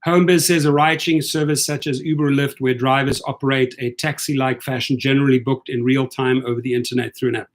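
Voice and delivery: normal talking voice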